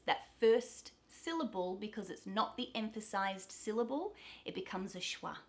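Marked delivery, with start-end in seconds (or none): none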